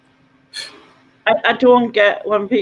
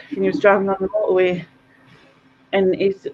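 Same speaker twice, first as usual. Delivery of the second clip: ordinary talk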